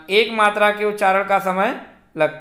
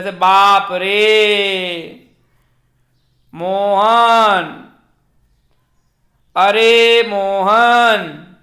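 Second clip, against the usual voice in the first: long stretched vowels, calling from afar; stretched calling voice, vowels held triple length; drawn-out call; 5 dB louder, much slower than usual. Shown as a demonstration. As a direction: hold each vowel long as if calling someone far off. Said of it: "दूर से पुकारने में व्यवहृत".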